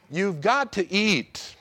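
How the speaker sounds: ordinary talk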